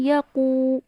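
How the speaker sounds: قو held for two counts; Quran recitation. Shown as a demonstration. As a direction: stretch قو in یقو. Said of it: "اس کو میں نے ٹو counts دیے". (قو کو دو حرکت لمبا)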